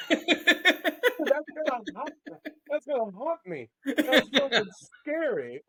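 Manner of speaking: high squeal of real laughter through words; spooked but cracking up, voice shooting high; helpless laughter. Real, not acted.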